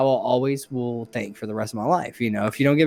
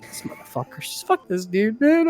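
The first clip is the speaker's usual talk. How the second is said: mock crying; 7 semitones higher than usual. Worker eating frozen cookie alone in freezer, mock tearful grumbling. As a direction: grumble to yourself as if crying mad. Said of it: "I was crying".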